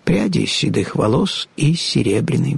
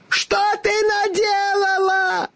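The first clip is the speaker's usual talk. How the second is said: high, slow, horrified shriek; shrieking witch; slower than usual. A narrator's reading. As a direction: shriek as if melting away. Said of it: "завизжала волшебница".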